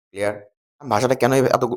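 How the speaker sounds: ordinary talk, nothing marked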